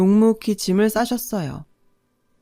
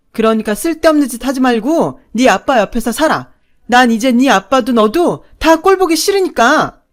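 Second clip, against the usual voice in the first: loud, higher, cutting; cruel shouting; 4 semitones higher, 7 dB louder than usual. A narrator's reading. Stepmother telling the girl she is not her daughter, cruel shout. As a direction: hurl it at the child to wound.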